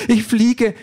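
excited call. A narrator's reading. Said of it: "ruft sie aufgeregt".

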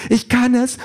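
elated high-pitched call; excited cry. A narrator's reading. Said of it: "ruft sie".

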